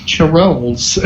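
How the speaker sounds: "Charles" said as "cha-ROLLS"; playful mispronunciation. Pretend. Teasing the arriving medic, "Charles" said as "cha-ROLLS". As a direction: break "Charles" into two syllables, the second rhyming with "holes".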